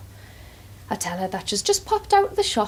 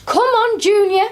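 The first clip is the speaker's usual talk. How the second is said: high, loud cheer at the race; shout; 12 semitones higher, 8 dB louder than usual. Retold or imitated. Carer shouting the horse home for Ethel, loud cheer. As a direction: yell the horse on, full voice. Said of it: "I shout".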